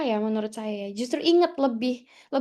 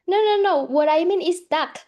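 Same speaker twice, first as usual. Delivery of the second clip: higher, louder retold denial; emphatic correction; 5 semitones higher, 6 dB louder than usual. Housemate correcting the duck and dog mix-up, emphatic retold correction. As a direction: jump in to clear up the mix-up. Retold friend clearing up misunderstanding.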